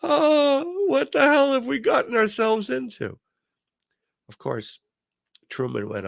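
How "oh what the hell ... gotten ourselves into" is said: collective dismay. Retold or imitated. Listeners hearing the new president, high mock dismay. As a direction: groan it high, as if all is lost. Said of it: "All of us were thinking".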